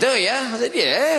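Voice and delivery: high, quizzical questions; mock surprised. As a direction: answer cheekily high. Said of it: "He said".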